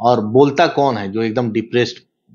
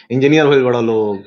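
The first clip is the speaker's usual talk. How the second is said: amused mimicry, a little raised; 2 semitones higher, 3 dB louder than usual. Guest replays a star's jibe to the interviewer, light mimicry. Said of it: "जिस tone में वो बोले ना".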